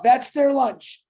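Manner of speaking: ordinary delivery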